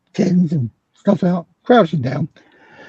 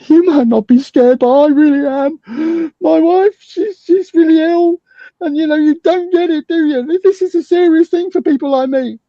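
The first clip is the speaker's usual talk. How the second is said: much higher, trembling-sounding pleading voice put on to disarm him; mock frightened plea; 13 semitones higher, 3 dB louder than usual. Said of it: "I pulled my mask down and went".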